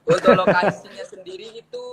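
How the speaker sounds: laughing through the words; high giggly answer. Real, not acted. Tertawa saat menjawab lokasi. (sambil tertawa)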